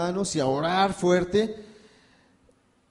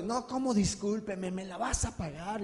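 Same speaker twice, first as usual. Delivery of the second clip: menacing big man; 5 semitones higher, 4 dB quieter than usual. Retold or imitated. Big man menacing the short brother, mildly threatening. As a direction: growl out the threat without raising volume.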